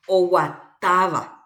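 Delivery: "oguatáva" with a loud stressed TA. Shown as a demonstration. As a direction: hit the TA in "oguatáva" firmly. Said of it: "a ári jahai". ("oguatáva" con acento fuerte en TA)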